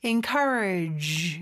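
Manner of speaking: slow encourage, final G softened like French ዥ; elegant final G. Shown as a demonstration. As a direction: say encourage slowly, with a refined buzzed -ge. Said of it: "고급스럽게 발음해 주시면".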